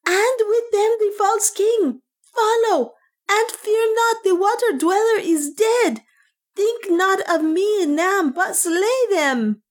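very high, slow, drawn-out cry; scream. A narrator's reading. Priest ordering followers to pursue and slay, screamed. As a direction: cry it high, stretching the last words. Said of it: "he screamed".

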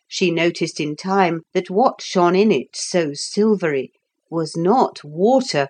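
plain reading voice

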